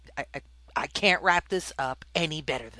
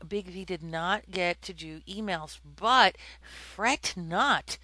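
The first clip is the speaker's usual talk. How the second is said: ordinary delivery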